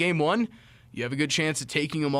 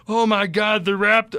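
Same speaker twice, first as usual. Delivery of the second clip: a higher, louder [name] voice, lightly done; [name] impression; 6 semitones higher, 7 dB louder than usual. Vlogger imitates [name] cheering at a Raptors game, lightly voiced and louder. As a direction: hype it up like an excited [name]. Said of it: "that was the best [name] accent ever".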